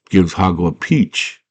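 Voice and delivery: ordinary delivery, nothing marked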